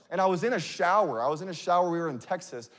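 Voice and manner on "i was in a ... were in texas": normal talking voice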